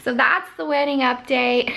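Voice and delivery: normal talking voice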